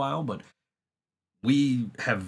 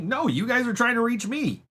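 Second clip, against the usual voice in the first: higher, exasperated pushback; exasperated retort; 8 semitones higher than usual. Retold or imitated. Telling off the caller, exasperated and higher. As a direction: push back, baffled and fed up. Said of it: "I'm like".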